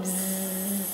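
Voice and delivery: zzz with the throat vibrating; voiced buzz. Shown as a demonstration. Teacher shows the vibrating version after the silent one. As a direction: let Zzz hum in the throat. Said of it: "Không rung, rung".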